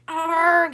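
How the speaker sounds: loud high pained yell; sudden loud yowl of pain. A narrator's reading. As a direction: cry out as teeth hit iron. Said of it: "he yelled".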